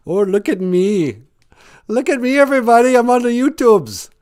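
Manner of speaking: very high, loud mock show-off voice; high silly look at me, everybody; show-off squeal. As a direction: preen for the whole live stream. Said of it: "I say that a lot".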